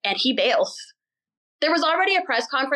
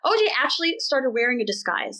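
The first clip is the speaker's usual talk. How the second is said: normal talking voice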